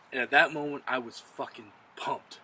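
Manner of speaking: ordinary talk, nothing marked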